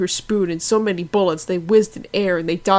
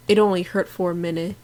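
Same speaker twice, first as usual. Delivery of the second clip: plain reading voice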